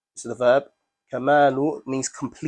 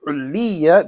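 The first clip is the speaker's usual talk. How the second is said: Uliya said higher and louder, stressed; 4 semitones higher, 5 dB louder than usual. Shown as a demonstration. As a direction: lean hard on the middle of Uliya. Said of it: "It's stressed in that word".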